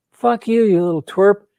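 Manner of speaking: high, loud mock outrage; hammed-up angry brush-off; indignant rebuff. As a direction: snap it as the offended girl in a joke. Joke girl rejects him, slaps him.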